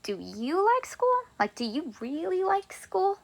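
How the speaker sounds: mock pressing a student; insistent question. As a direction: push the question with curiosity. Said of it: "if I really wanted to ask you".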